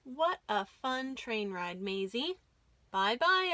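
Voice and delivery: ordinary delivery, nothing marked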